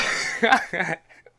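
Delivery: loud high laugh; burst of laughter. Real, not acted. Narrator cracking up at the meme in her head. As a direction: crack up mid-sentence.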